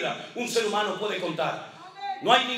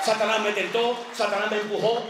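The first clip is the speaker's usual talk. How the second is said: normal talking voice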